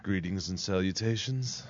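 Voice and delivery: put-on phone-line seduction voice; mock-sultry hello; phone-sex voice. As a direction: greet them like a phone-sex operator. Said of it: "was that your phone sex voice?".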